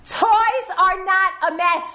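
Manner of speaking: "toys" hit high and loud, "toys are not a mess" called out; loud exclaim. Shown as a demonstration. Speaker proclaims her parenting motto to parents, high and loud. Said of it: "I always tell people".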